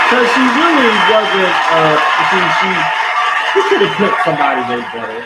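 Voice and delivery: very loud, high-pitched protest; outraged shouting. Real, not acted. Viewer protesting a casting choice, shouting. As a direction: start at a yell, pitch easing down.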